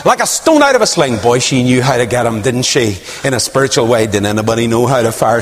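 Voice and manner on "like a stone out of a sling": pleading encouragement. Retold or imitated. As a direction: urge him on to trust.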